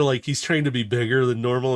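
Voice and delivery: ordinary talk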